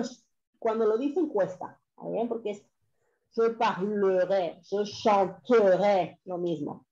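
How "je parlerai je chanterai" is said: je parlerai, je chanterai, slow, the e in the middle swallowed; unstressed e dropped. Shown as a demonstration. Teacher models the future tense slowly for students, e silent. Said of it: "La E final no suena".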